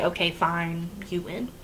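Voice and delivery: quiet, fading mock surrender; soft defeated okay fine; resigned giving up. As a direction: sigh it out like you've given up. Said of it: "She's ready to say".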